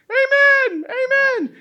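high, loud mock amen; joking amen. Preacher joking to congregation, hammed-up high amen. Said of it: "I'm like".

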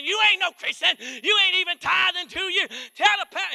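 shrieking high, loud tirade at her husband; wife going off. Retold or imitated. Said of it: "she's going off like that".

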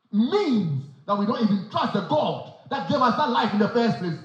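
ordinary delivery

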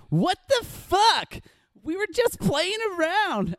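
put-on very high, slow girly voice; girl voice. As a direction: squeal it like an offended girl. Girls behind him react.

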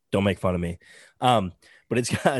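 normal talking voice

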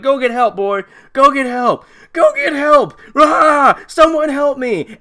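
escalating high yells for help; mock desperate shouting. Injured man sending the dog for help, mock desperate shout. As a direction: plead loudly, hammed-up, building to a wail.

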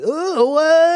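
very high, loud vocal whoop; regrouping vocal call. Shown as a demonstration. Co-host showing how to regroup, high loud call. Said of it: "in order to regroup, you go like".

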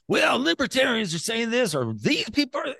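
lightly mocking protest; mock objection. Mimicking objectors' complaint.

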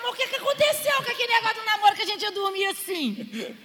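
high, fast comic protest; mock indignant. Newlywed wife protesting to her husband, indignant. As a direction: rattle off a hammed-up complaint. Wife's indignant retort, retold.

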